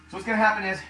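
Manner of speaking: winded after the exercise set; out of breath. Real, not acted. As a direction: talk while still catching breath. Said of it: "I'm out of breath now".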